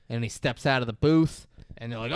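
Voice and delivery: usual speaking voice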